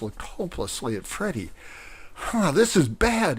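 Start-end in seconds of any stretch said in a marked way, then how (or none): 2.1-3.4 higher, louder alarmed shout. Acted. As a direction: shout over the cheering crowd. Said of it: "he shouted".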